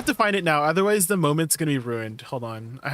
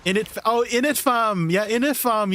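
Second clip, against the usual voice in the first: higher, louder put-on London accent; a game try at London slang, higher and louder; mock London slang; 6 semitones higher, 4 dB louder than usual. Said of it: "teach me some British sayings".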